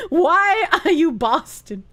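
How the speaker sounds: ordinary delivery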